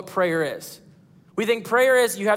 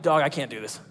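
exasperated mock groan; 9 semitones lower, 4 dB quieter than usual. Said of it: "I'm like".

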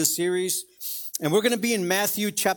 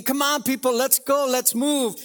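higher-pitched impatient yell, only half raised; mock yelling; 5 semitones higher than usual. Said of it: "as I'm yelling at people".

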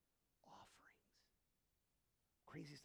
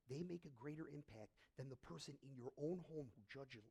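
ordinary talk

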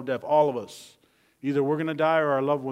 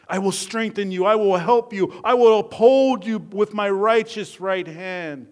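loud, raised-pitch proclamation of God's promise; forceful scripture preaching; 6 semitones higher, 9 dB louder than usual. Acted. God promising help to the fearful, preached forcefully. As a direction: declare each promise with mounting force.

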